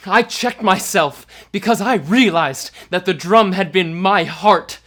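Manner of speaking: high, loud and driving to the end; fevered shout. A narrator's reading. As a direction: stay at full cry through the reveal. Narrator at fever pitch.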